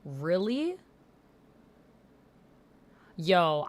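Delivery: ordinary talk, nothing marked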